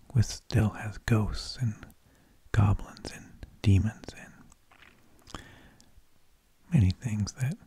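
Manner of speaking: usual speaking voice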